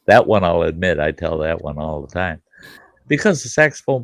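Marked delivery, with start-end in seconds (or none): none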